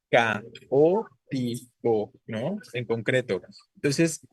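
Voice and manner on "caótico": "Caótico" muy lento, ca-o-ti-co; separado en sílabas. Shown as a demonstration. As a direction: separa con pausas cada sílaba de "Caótico". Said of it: "Es palabra esdrújula". ("Caótico" sílaba por sílaba, muy despacio)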